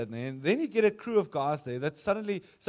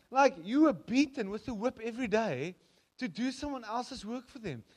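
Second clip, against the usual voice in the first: ordinary talk, nothing marked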